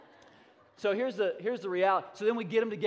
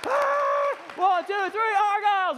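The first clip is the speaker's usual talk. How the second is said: very high, loud rallying yell; hyped team cheer; 11 dB louder, slower than usual. Acted. Hype girl firing up the team, screamed cheer. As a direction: scream the countdown and team name. Demonstrating team's fired-up cheer.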